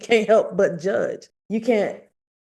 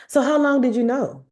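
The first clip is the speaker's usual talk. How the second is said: pointed question; 7 semitones higher, 4 dB louder than usual. Retold or imitated. Confronting mom on phone.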